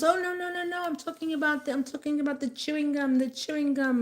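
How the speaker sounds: high, hurried denial of a caught woman; mock her high, flustered backpedal. Vlogger imitating the woman behind her in line, high and flustered. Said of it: "she goes".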